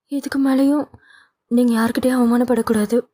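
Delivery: high, tearful pleading through sobs; cling on and beg him not to go; sobbing plea. A narrator's reading. Daughter clinging to her father, begging through sobs. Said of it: "விம்மியபடி".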